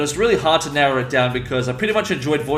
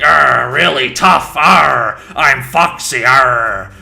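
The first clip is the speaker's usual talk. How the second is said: loud, gravelly tough pirate growl; gravelly pirate Foxy; 7 dB louder, much slower than usual. Acted. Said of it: "sound kind of gravelly and just kind of like".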